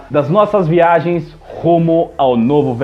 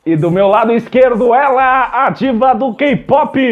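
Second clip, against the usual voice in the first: podcast host intro; 8 semitones higher, slower than usual. Acted.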